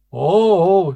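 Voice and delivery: loud high cry of fright; shouted oh. Acted. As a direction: shout over the noise. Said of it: "she shouted over the racket".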